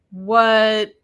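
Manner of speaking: ordinary talk, nothing marked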